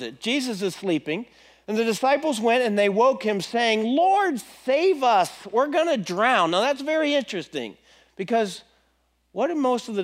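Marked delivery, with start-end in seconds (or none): 3.8-6.5 high, urgent cry for rescue; panicked plea. Acted. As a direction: plead like a man about to drown. Disciples panicking in the storm.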